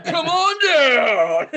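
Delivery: high, loud, slow announcer boom; monster truck ad. Pretend. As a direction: hype it like a monster truck commercial. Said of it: "a monster truck announcer to do a commercial".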